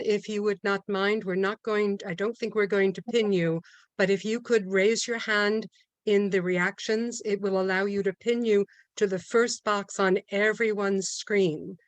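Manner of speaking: normal talking voice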